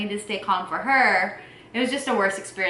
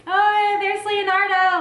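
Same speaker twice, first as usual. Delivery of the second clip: forced cheer; 12 semitones higher, 5 dB louder, slower than usual. Retold or imitated. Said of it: "trying to keep Sandra calm".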